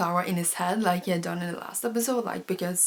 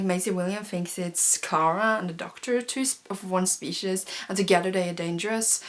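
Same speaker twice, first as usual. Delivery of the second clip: ordinary talk, nothing marked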